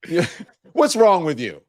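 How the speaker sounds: mock scolding mother, high and loud; mom scolding. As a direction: play a mom telling off her boy. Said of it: "That was what my mom would do".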